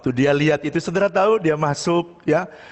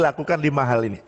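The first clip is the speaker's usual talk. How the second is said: ordinary talk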